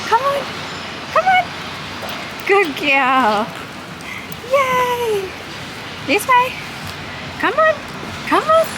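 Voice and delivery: high squeaky coaxing dog praise; squeaky praising a dog. Real, not acted. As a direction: call the puppy on in a high voice. Coaxing puppy.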